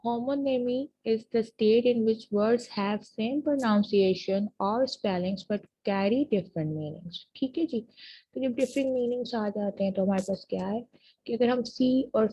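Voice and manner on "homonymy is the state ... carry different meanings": very slow, word by word for students to write down; slow dictation. Shown as a demonstration. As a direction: dictate the definition slowly. Dictating the definition a third time.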